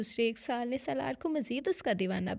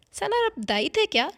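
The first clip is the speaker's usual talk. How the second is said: higher, louder wide-eyed mock innocence; innocently teasing; 9 semitones higher, 7 dB louder than usual. Wife innocently teasing her husband, feigned naivety. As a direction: ask sweetly while landing the jab. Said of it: "जिमल ने मासूमियत से".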